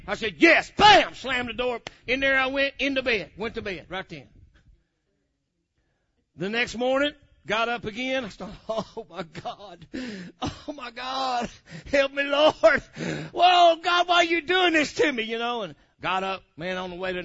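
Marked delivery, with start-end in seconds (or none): 0.4-1.2 loud, high snapped yes and bam. Retold or imitated. Answering his wife then slamming the door, loud. 8.7-15.2 slow start building to a high loud cry. Retold or imitated. Begging God for relief from craving, building shout.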